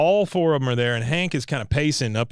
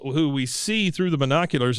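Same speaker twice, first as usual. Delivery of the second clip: normal talking voice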